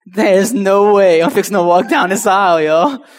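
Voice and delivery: loud, high, hammed-up no way; comic loud protest; defiant refusal. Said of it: "my mom like".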